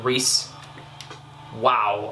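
ordinary talk, nothing marked

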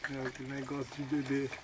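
whispering while hiding. Acted. Escondidos no mercado à noite.

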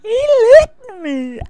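high, loud shriek of disbelief; shocked shriek. Real, not acted. Player reacting to being licked in the game, shocked shriek.

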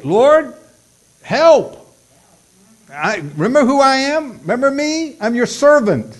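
high, loud cry for help; urgent prayer plea. Shown as a demonstration. Preacher showing how to pray when it's critical, urgent loud pleading. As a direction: call out insistently, voice pitched well up.

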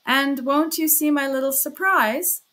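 high, loud teasing question; chuckling, playful. A narrator's reading. Porfiry stops him at the door, playfully teasing. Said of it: "chuckled Porfiry again".

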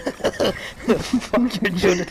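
slow, broken up by laughter; laughing. Real, not acted. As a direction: say it through giggles. Repeating line while laughing.

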